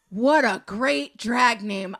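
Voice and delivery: slow, drawn-out delight. Acted. Repeats it savoring the name.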